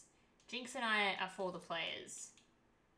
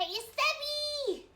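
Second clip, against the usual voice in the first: high, drawn-out call of the name Savvy; delighted hello; excited greeting; 5 dB louder, much slower than usual. Real, not acted. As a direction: sing out the name in welcome. Greeting friend joining.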